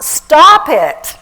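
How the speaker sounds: sharp, loud scolding command; firm stop. Real, not acted. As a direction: cut them off hard. Scolding the audience.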